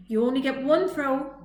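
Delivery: put-on bossy older robot; bossy order. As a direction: lay down the rule to the little one. Rusty voiced in story.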